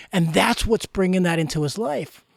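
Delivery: normal talking voice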